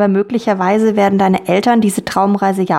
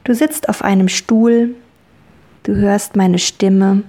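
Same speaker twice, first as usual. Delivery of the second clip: guided meditation. Acted. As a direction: ease a child into calm. Leads a children's dream journey.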